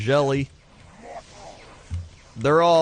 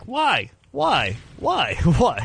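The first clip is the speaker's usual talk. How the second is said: put-on high, insistent child asking why; mock toddler pestering why; curious four-year-old; 8 semitones higher, 4 dB louder, much slower than usual. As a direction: play the nagging little kid. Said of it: "that four-year-old that's like".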